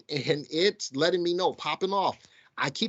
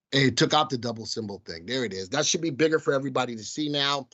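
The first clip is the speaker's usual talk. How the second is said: ordinary talk, nothing marked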